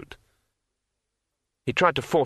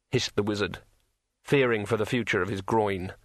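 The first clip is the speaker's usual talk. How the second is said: usual speaking voice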